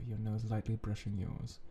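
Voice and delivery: ordinary delivery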